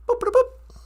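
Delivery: high, chirpy mouth-made boops; computer beeps. Pretend. Playing holodeck, imitating computer beeps. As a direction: beep like a sci-fi computer.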